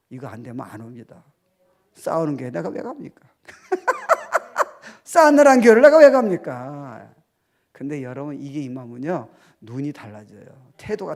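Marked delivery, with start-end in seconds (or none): 3.5-5.0 laugh. Real, not acted. Laughs at own line. 5.0-7.1 higher, louder rhetorical question with 왜 stressed. Shown as a demonstration. Pastor challenges the congregation, loud preaching. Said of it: "repeated louder after laugh".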